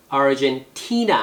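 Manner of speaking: "Argentina" with a long, stressed TI. Shown as a demonstration. Teacher models the country name, stress on TI. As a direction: lean on the "ti" in "Argentina". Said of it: "T의 액센트가 있죠?".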